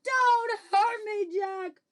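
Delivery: high, loud, slow begging wail; yelled plea. Acted. Giant begs Jack not to hurt him, yelling. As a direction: cry out, pleading for mercy. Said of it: "he yelled".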